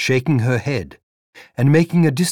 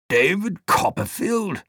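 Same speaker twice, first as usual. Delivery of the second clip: put-on dreamy old gentleman searching his memory; vague old man; 8 semitones higher than usual.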